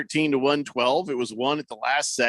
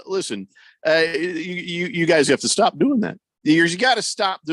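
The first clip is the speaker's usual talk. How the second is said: ordinary talk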